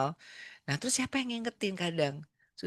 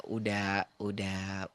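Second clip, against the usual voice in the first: usual speaking voice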